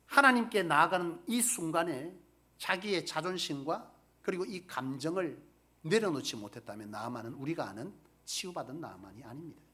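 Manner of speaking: ordinary talk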